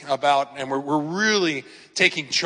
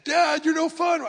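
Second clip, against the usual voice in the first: put-on much higher, slow pleading teen daughter voice; whiny daughter; 13 semitones higher than usual. Father retelling his daughter protesting the anime ban, high whine. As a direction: whine it like a teen girl begging dad.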